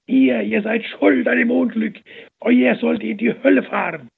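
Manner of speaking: high, loud furious tirade; angry crowd cursing the nuns. A narrator's reading. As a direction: spit curses at the nuns. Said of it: "die Nonnen zu verfluchen".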